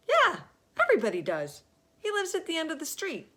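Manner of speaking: put-on high, loud, quick child voice; eager little boy. Little boy telling Alexander where the mayor lives, high and quick. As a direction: rattle it off like a keen kid.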